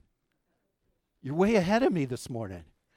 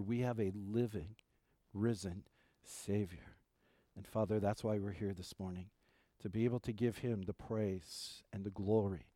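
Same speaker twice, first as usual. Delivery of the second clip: soft, low, reverent prayer; hushed pastoral prayer with measured pauses; 6 semitones lower, 9 dB quieter than usual. Real, not acted. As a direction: pray gently, voice dropping at phrase ends. Leads the congregation in prayer.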